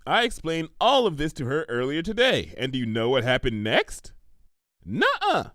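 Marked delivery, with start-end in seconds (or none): 4.8-5.5 much higher pony voice; playful denial; high indignant guess. Acted. Pinkie answers Twilight's question, high and eager.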